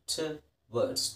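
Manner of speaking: ಟುವರ್ಡ್ಸ್ said slowly and softly; ಟುವರ್ಡ್ಸ್ drawn out quietly, tu-wards; pron. Shown as a demonstration. Teacher slows ಟುವರ್ಡ್ಸ್ down for the students. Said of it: "slow model".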